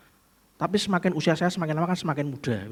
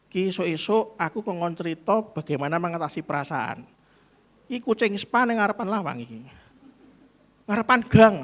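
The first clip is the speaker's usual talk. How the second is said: ordinary talk, nothing marked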